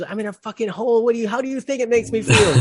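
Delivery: acted-out exasperated outburst, high and loud; angry. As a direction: fire it back fast and fed up, in a retold rage. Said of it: "by now the guy's angry".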